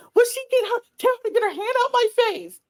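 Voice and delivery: high, fast mock whine; imitating Kayla. Podcaster mocking Kayla's complaint, high fast whiny voice. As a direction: squeak it out as a mocking impression.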